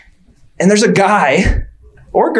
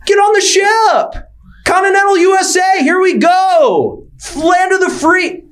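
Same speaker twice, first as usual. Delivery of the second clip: mock slave-ship captain; 11 semitones higher than usual. Comic calling to the crowd, high mock shout. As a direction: bark it up high like hauling people aboard.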